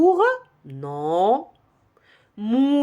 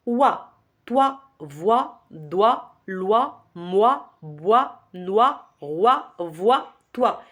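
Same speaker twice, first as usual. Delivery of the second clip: syllables in brisk succession, each rising; quick syllable drill; much slower than usual. Shown as a demonstration. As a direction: rattle off the syllables with little pause. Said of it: "J'ai fait vite".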